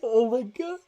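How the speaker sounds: choked, laugh-crying disbelief; half crying it out in disbelief; teary disbelief. Real, not acted. Friend reacts to the pick, choked teary disbelief.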